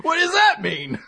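high, drawn-out puzzled "Capitone?"; a bewildered guy puzzling over a word; baffled mock confusion. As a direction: stretch it out, utterly lost. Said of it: "a dude who's just like".

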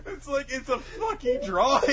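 high, helpless exasperation through laughter; amused, squeaky protest; laughing. Real, not acted. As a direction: argue while cracking up. Cracking up while arguing.

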